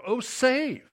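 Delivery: "save" said high and firm as the right meaning; stress on save. Shown as a demonstration. Preacher correcting the meaning, stressing "save". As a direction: land hard on "save".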